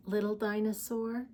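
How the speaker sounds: ordinary talk, nothing marked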